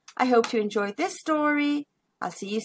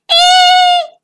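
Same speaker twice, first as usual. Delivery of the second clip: very high loud squeal; looping squeal; 12 dB louder than usual. Acted. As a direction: shriek on the loop. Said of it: "Ladybug cried".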